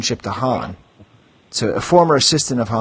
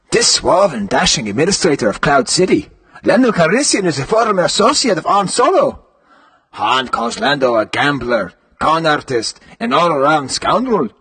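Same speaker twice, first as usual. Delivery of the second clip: put-on higher, roving British Isles accent; 3 semitones higher than usual. Host reading the Lando card in a put-on British Isles accent. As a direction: do a shifting British accent, hammed up. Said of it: "Should I do an accent".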